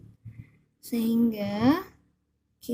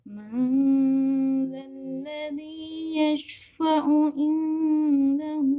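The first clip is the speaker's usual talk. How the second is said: Quran recitation; 2 semitones higher than usual. Acted. Recites Ayat al-Kursi.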